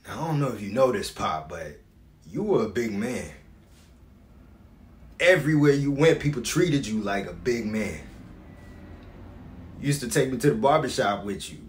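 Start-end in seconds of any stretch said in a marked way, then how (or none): 5.2-8.0 loud, emphatic opening easing off; proud declaration. Acted. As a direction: declare it with pride in your father. Son recalls his father's stature.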